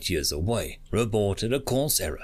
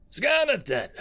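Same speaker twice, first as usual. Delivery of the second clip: shouting; 11 semitones higher, 3 dB louder than usual. A narrator's reading. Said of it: "shouted Carmack".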